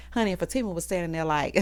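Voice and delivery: ordinary delivery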